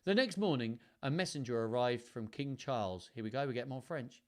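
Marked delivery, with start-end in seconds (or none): none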